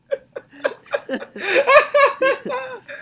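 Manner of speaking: loud, high burst of laughter; big laugh. Real, not acted. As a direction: crack up loudly at the joke. Laughing at own story.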